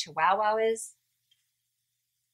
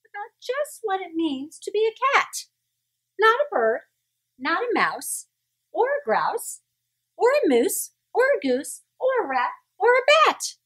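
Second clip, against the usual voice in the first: rising scolding lecture; 10 semitones higher, 4 dB louder than usual. A narrator's reading. Mother lecturing her kitten, building to a high loud peak. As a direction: pitch climbing higher and louder, list building. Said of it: "said his mother".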